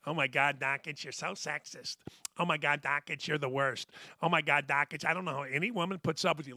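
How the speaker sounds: low, quiet mock scold; mocking woman. Host voices coworker Lee scolding him for being sexist, mocking. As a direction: tease like an exasperated coworker. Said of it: "She's standing over there".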